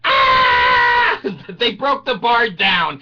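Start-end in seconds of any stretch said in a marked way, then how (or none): 0.0-1.2 high, sustained scream; hammed-up roller coaster scream; loud scream. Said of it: "the people are screaming".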